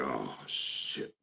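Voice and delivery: low, very soft interjection. Retold or imitated. Imitating the singer cutting in on the remix, low and hushed. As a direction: drop deep and quiet under the music. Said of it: "that was [name] saying".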